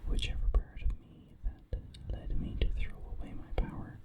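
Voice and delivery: plain reading voice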